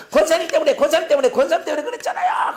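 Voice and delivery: high, loud repeated accusation; mock enraged yelling. Pastor blaming an elder woman, acting out a furious outburst. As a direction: blow up at her, pitch climbing, as a demonstration. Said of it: "화를 내면 입에 거품을 물고 막".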